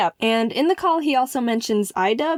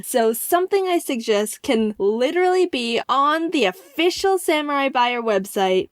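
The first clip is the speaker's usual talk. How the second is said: normal talking voice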